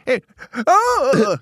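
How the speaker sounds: put-on startled high old prospector yelp; old coot jumping at a noise.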